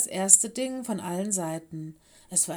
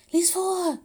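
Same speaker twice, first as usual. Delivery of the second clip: high, louder, insistent plea; urging; 11 semitones higher, 5 dB louder than usual. A narrator's reading. Mino urging a friend to read out the task, insistent. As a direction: press them to get on with it. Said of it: "drängte Mino".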